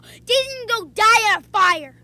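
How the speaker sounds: angry gamer outburst; raging. Real, not acted. Kid raging at Daisy in the race, angry. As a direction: snap at the game in a rage. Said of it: "you see how I was just raging there".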